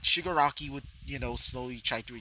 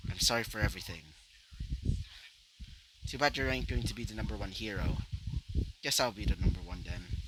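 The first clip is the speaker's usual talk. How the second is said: low, slightly softened voice; subdued apology; 5 semitones lower, 6 dB quieter than usual. Acted. Hero saying sorry before leaving, quietly subdued. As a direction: keep it low and understated.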